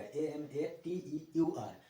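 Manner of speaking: slow, letter by letter: A M A T E U आर; dictating the spelling of amateur. Shown as a demonstration. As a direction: spell A M A T E U आर with a pause after each letter. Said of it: "amateur के spelling पे ध्यान दीजिएगा".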